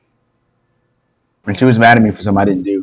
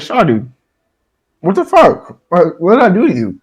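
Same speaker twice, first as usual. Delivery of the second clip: higher-pitched bewildered demand; retold indignant protest; 7 semitones higher than usual. Boy asking girl why she hit him, retold indignation. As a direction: plead for a reason, pitch jumping up.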